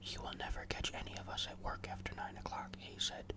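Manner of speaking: plain reading voice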